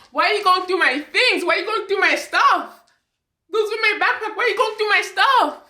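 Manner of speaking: very high, wailing indignant demand; having a breakdown. Retold or imitated. Daughter having a breakdown at mom over her things, distraught. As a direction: repeat it in rising, high-pitched distress. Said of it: "a breakdown at this point".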